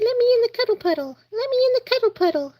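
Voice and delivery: high, fast squeaky little-bird plea; high squeaky baby bird voice. Pretend. Vlogger voicing a chick wanting into the huddle, high squeaky plea. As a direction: squeak it like a tiny chick begging. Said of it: "He said".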